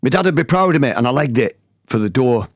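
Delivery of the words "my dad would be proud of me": raised, higher-pitched reenacted shout; storyteller acting out his own shout; retold shout. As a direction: call it out proudly, pitch jumping up. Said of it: "then I shouted the words".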